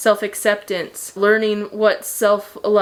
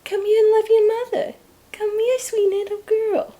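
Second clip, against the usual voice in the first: overwhelmed by cuteness; 13 semitones higher than usual. Real, not acted. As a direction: squeal sweetly at the pet. Calling pets in baby voice.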